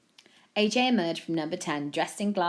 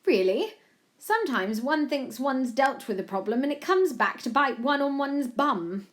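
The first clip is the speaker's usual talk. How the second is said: higher regal voice, warning; doubting Queen; 8 semitones higher, 5 dB louder than usual. A narrator's reading. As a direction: caution him like a queen.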